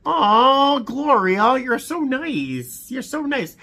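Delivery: high, slow, moved gushing; touched and tender. Real, not acted. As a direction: let the kindness get to you. Moved by a kind card.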